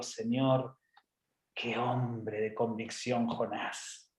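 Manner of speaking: soft, slow, low reverence; awed admiration. Acted. Preacher to the Lord about Jonah, hushed awe. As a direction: savour it slowly, almost to yourself.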